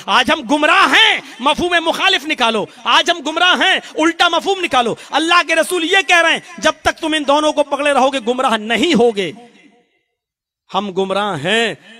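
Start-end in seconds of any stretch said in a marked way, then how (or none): 0.1-9.3 fiery preaching. Acted. Preacher calling the congregation to Allah, loud and high. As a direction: summon the crowd toward God and the Prophet.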